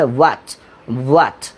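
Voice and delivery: "what" begun on a rounded w like Arabic waw; pushed W, no h. Shown as a demonstration. Teacher models the sound for students, pushed w without h. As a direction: give "what" a little push on the w, no h. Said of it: "একটু ধাক্কা দিয়ে বলতে".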